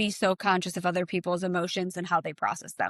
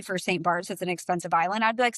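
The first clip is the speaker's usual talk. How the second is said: ordinary talk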